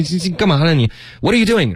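high, fast challenge; quick mock-accusing question; acted reproach. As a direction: demand it as if catching someone out. Acts out catching someone.

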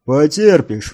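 loud, authoritative order; commanding. A narrator's reading. Warrior overrules woman's complaint of pain, commandingly. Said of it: "властно сказал воин".

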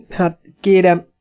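a touch of nervous stammer. A narrator's reading. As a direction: fumble for the words.

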